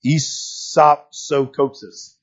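isopsokosis said very slowly, syllable by syllable; Greek word spelled out. Shown as a demonstration. Preacher teaching the congregation the Greek word, very slow and careful. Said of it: "The word is".

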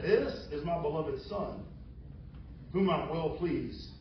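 God's voice. Acted. Voice from the cloud.

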